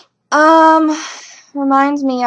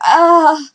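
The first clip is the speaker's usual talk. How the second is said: high, loud anguished groan; 7 semitones higher, 8 dB louder than usual. Retold or imitated. Fan groaning at the feeling a knight will die, anguished. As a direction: let out a pained groan. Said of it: "and I'm like".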